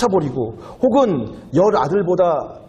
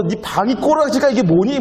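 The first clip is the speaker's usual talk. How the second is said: scolding mom; 8 semitones higher than usual. Retold or imitated. Mom scolds about messy room.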